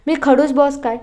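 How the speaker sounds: a playful offended squeal; indignant surprise. Acted.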